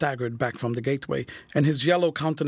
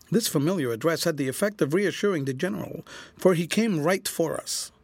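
ordinary delivery, nothing marked

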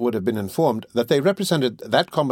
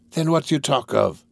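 mildly cross, raised pitch; angry; 5 semitones higher than usual. A narrator's reading. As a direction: retort with a touch of anger.

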